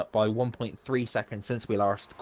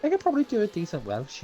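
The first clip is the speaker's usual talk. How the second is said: put-on Welsh accent; 9 semitones higher, faster than usual. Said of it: "that was kind of Irish".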